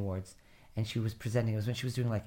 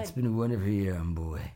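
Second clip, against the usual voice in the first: Kathleen Turner impression; 4 semitones lower than usual. Shown as a demonstration. Imitating Kathleen Turner flubbing Broadway at the Tonys. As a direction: do her slurry Tony Awards line. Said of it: "as Kathleen Turner".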